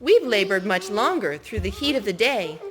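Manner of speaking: a touch of whining workers; mild whine. Acted. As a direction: lightly complain as grumbling workers. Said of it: "these men whined".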